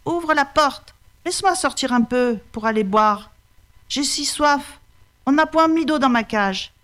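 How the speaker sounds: high, wheedling tiger voice; a sly tiger begging to be let out; high-pitched crafty tiger; pleading tiger. Acted. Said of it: "dit le tigre".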